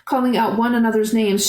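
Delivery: ordinary delivery, nothing marked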